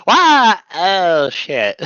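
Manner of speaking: sudden high yelp, then a startled curse; Wobbuffet impression. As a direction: pop out with a squeal. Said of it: "where he's like".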